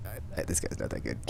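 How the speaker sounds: breathy whisper, kept secret; whispered aside. Retold or imitated. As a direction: whisper it so no one hears. Private thought about bad teammate.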